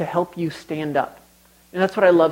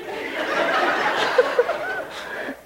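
high, breathless laughing fit; helpless laughter; 6 dB quieter than usual. Real, not acted. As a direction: crack up until you can hardly breathe. Speaker cracks up at own story.

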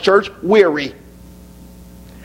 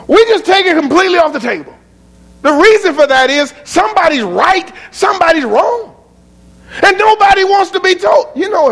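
fervent preaching; 12 semitones higher than usual. Acted. Preacher to congregation, heated and climbing. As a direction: hammer the point home. Preacher arguing about right and wrong.

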